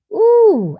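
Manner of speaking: a high, loud ooh of delight. Real, not acted. Preacher thrilled by a viewer's good word, high ooh.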